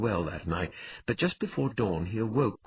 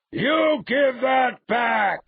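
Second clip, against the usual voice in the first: very high, long-drawn bellow; enraged brute's shout; 8 dB louder, much slower than usual. Acted. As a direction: bellow in protest, stretching every word. Robbed brute shouting in protest.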